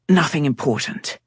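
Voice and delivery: ordinary delivery, nothing marked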